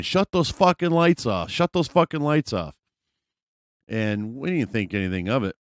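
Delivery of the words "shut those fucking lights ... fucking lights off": high-pitched repeated yell; mock a woman screaming in irritation; high, insistent retold holler; imitated screaming. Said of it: "she's just screaming".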